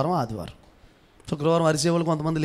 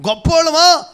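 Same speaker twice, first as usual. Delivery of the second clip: high, loud rhetorical question; climactic challenge; 12 semitones higher, 10 dB louder than usual. Real, not acted. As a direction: cap the list with a shouted question. (గొంతు పెంచి గట్టిగా ప్రశ్న)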